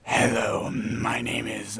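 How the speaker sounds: slow, drawn-out spooky intro; a touch of ghostly drawl. Pretend. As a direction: stretch it out like a lightly spooky audition. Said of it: "Do a spooky voice".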